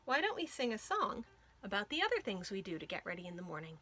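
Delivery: ordinary delivery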